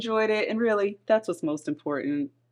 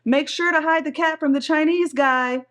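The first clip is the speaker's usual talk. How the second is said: higher, raised voice for a quoted line; loud call; 9 semitones higher, 5 dB louder than usual. A narrator's reading. Son calling out about the delivery driver, loud call. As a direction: call it out so the whole doorway hears. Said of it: "My thirteen-year-old son loudly says".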